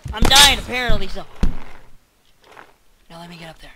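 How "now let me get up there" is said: barely audible mutter to himself. Real, not acted. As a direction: say it under your breath, much softer. Muttered to himself, then repeated.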